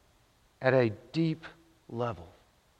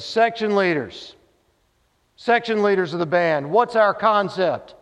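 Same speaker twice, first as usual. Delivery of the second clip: slow, loud commanding call to the section leaders, higher; band director's call; 5 semitones higher, 5 dB louder than usual. Retold or imitated. Band director in the movie demanding the band's concept, slow and loud. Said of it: "Doctor Lee looks over and says".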